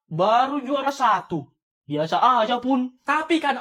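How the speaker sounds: ordinary talk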